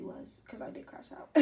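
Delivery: breathy whisper, almost gone; whispered admission. Real, not acted. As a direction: confess it under your breath. Confession, whispered.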